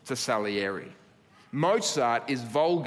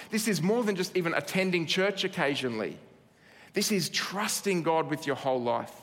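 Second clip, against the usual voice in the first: usual speaking voice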